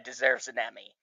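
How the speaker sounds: ordinary delivery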